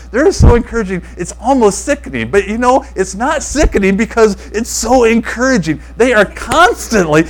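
high-pitched imitation of a gushing friend; giddy, smiling big. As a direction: play him delighted, grinning as you talk. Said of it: "he's smiling so big".

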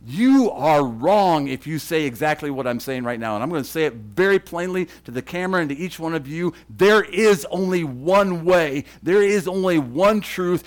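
loud, emphatic preaching to the camera; forceful preaching. Acted. Preacher to viewers, loud and emphatic. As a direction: hammer home every line from the pulpit.

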